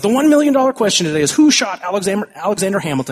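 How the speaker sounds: hyped radio quiz host announcing the question. Retold or imitated. Radio host voice in retold commercial.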